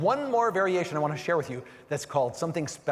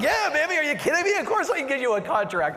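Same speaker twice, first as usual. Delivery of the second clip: much higher and louder, bursting with glee; inner elation; 11 semitones higher, 5 dB louder than usual. Retold or imitated. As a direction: let the hidden thrill explode out. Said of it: "But on the inside, I'm like".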